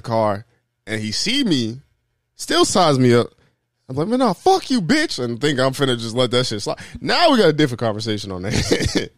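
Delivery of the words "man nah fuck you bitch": very high mock-angry burst; imagined angry outburst. Son confronting driver who sized him up, mock angry. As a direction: flare up in pretend outrage, pitched high.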